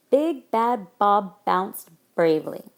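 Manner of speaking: every B in "Big Bad Bob bounced bravely" punched loud; slow, loud reading hitting each initial B in "Big Bad Bob bounced bravely"; stressed B sounds. Shown as a demonstration. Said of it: "the B sound is emphasized".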